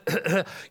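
put-on hacking cough; fake coughing fit. Patient coughing to the doctor about his symptoms, fake cough. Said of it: "I'm coughing up blood".

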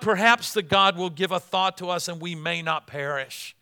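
high, loud impassioned preaching; full-force preaching. Acted. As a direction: preach it out to the whole church.

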